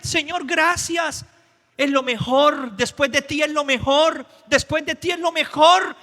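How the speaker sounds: thrilled man thanking God for his wife; overjoyed thanks. Pretend. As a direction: gush with gratitude. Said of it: "imagínense a él".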